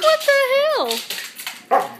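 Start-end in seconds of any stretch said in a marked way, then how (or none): 0.0-0.9 high, loud mock outrage, laughing; pitched-up, comic indignation; voicing Dudley's shock. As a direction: put startled words in Dudley's mouth, high and loud. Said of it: "Dudley's like".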